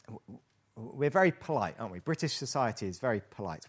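ordinary talk, nothing marked

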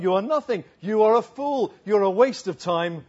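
hammed-up condemnation, dropping for the sign-off; mock put-down. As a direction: imitate an emphatic dismissal of someone proud. Family's mock put-down of the proud.

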